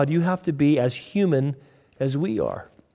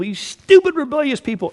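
high, loud furious yell; angry yell; 11 semitones higher, 5 dB louder than usual. Retold or imitated. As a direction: shout it at the rebels. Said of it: "He yells at the people".

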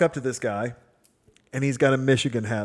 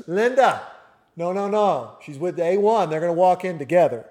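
higher, louder, quick mock insistence; emphatic insistence; 5 semitones higher, 8 dB louder than usual. Gate agent correcting Linda, higher and louder. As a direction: wave off the colleague, no no no.

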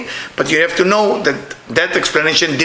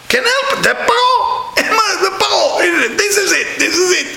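excited exclaiming; 14 semitones higher than usual. Real, not acted. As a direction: cry it out with delight. Excited exclaiming about Pharaoh.

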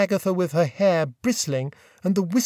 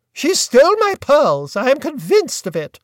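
high, louder firm accusation; imperious aunt; 8 semitones higher, 6 dB louder than usual. A narrator's reading. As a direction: declare it as settled fact. Aunt voiced in story.

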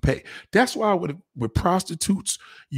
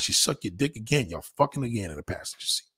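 ordinary talk, nothing marked

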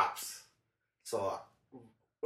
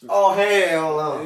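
slow, loud, high "oh hell no"; disgusted shout; 8 semitones higher, 16 dB louder, much slower than usual. Retold or imitated. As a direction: drag it out in horror. Reliving the disgusting moment.

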